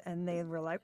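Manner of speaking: usual speaking voice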